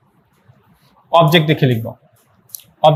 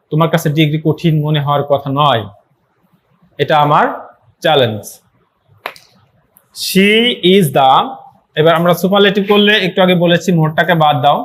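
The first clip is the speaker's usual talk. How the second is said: normal talking voice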